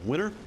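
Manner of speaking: "winter" without its T, like "winner"; dropped T. Shown as a demonstration. Streamer showing Michigan speech, dropped T in "winter". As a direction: swallow the T in "winter" so it becomes "winner". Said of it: "we don't enunciate our T's".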